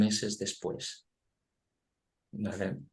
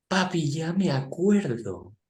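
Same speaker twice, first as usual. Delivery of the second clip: put-on child's voice; 7 semitones higher, slower than usual. Father quotes young son.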